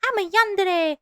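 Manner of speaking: put-on high, clingy anime girl voice; hammed-up obsessive anime girl; yandere voice. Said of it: "you will have someone be like".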